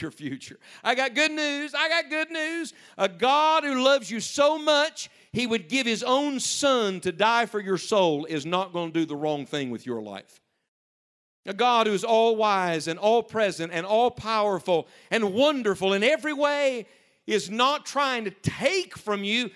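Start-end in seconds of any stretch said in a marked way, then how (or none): 0.8-1.7 much higher joyful proclamation; preacher lifting the whole room; excited preaching. Real, not acted. Preacher repeats with building fervour. 1.7-2.7 much higher, slow, stretched proclamation; preaching at full force; fervent good news. Real, not acted. Repeated, higher and slower. 3.0-19.5 higher, louder, slow impassioned preaching; full-force preaching. Real, not acted.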